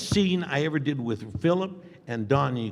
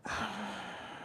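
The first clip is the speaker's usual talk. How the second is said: long sigh; 9 semitones higher, 12 dB quieter than usual. Shown as a demonstration. Showing his thinking pose.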